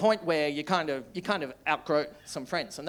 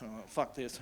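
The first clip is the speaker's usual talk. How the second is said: fed-up mutter; 8 semitones lower, 11 dB quieter than usual. Real, not acted. Fed-up aside.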